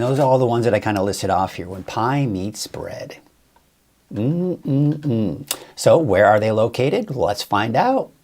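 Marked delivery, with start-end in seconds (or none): 4.1-5.5 yummy "mm-mm-mm"; higher, savoring hum; appreciative hum. Real, not acted. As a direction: hum over delicious-looking food. Admires the pies on screen.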